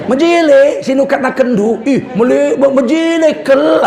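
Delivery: playful Karo imitation; Karo speech. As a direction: mimic a Karo speaker showing off. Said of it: "kalau orang Karo pakai kel".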